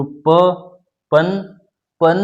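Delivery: प then पन, nasal added after the vowel; syllable breakdown. Shown as a demonstration. Teacher breaking पंच into parts for students. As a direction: split पंच slowly into प and पन. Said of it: "अर्थात प के बाद".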